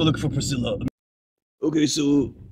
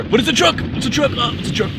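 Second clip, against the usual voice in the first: indignant outcry; 8 semitones higher, 4 dB louder than usual. Acted. Indignant protest about truck wash.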